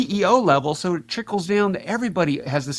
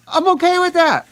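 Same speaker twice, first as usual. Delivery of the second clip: unbothered retort; 12 semitones higher, 5 dB louder than usual. Retold or imitated. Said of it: "And I'm like".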